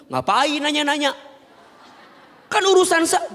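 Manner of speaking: high, loud indignant retort, cut off; mock a touchy wife snapping back; imitating a wife who takes offence; defensive wife. Wife's imagined defensive retort.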